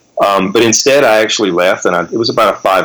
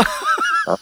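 real laugh, very high; cracking up after the impression; high squeal of laughter; 7 dB quieter than usual. Real, not acted.

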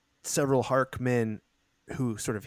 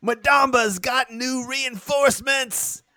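shouted alarm; 11 semitones higher, 6 dB louder than usual. Acted. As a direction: shout it to the whole company. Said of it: "he says".

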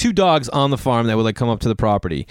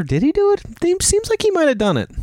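high, breathless wondering; whisper-gossip it way up high; mock friends speculating. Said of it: "Having your friends wonder".

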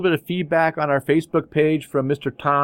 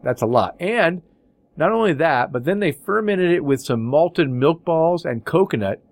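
usual speaking voice

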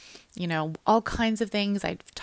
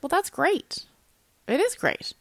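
overly bright; 9 semitones higher than usual. Retold or imitated. Retold reply to hopeful quitters.

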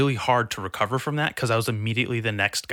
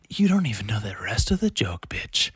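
whispered under the breath; whispered retort; 3 semitones lower than usual. Retold or imitated. Comic retells whispering a retort to his friend. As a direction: hiss it under your breath at him. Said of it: "And I'm like".

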